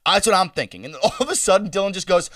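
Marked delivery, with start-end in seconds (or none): none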